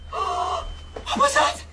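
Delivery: high, slow frightened cry; startled fright. Acted. Mother hearing the pirates coming, frightened. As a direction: jump at the sound.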